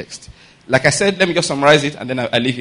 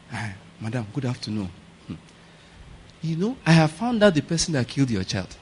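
hushed gossip; 4 semitones lower, 9 dB quieter than usual. Acted. Neighbour sneaks in with gossip.

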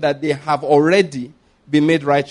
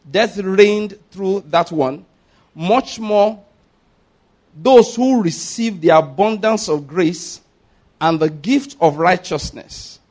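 slow, raised-pitch Bible reading; scripture proclamation; 4 semitones higher, slower than usual. Acted. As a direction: proclaim it phrase by phrase with heavy emphasis. Reads scripture aloud to congregation.

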